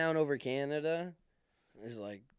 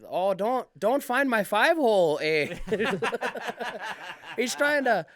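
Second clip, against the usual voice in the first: hammed-up Canadian-Irish voice, higher and louder; Irish-tinged Canadian bit, pitched up and loud; Canadian accent; 6 semitones higher, 6 dB louder than usual. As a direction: whine like a probed Canadian, lilting. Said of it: "your Canadian guy is sorta Irish".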